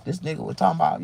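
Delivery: normal talking voice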